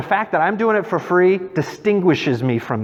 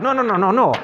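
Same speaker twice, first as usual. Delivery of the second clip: high-pitched mock protest from a hawker; pushy street artist; 7 semitones higher, faster than usual. Paris artist hounding a young tourist, pushy protest. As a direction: lightly imitate an insistent street artist. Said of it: "I can't speak in a French accent".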